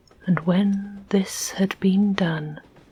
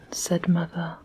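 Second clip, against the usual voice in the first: ordinary delivery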